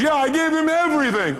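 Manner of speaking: high, whiny retelling of the caller's words; lightly hammed-up imitation of a caller; mimicked caller. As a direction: play the caller bragging she gave her all. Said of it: "He goes".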